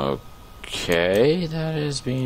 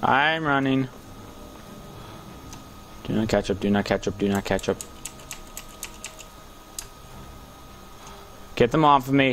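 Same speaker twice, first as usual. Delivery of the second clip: being chased; 4 semitones higher, 4 dB quieter, faster than usual. Real, not acted. Chased by enemies.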